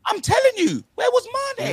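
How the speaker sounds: sudden high yell of disbelief; outraged shout. Real, not acted. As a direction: shout it out, fed up with the striker. Outraged banter about missing striker.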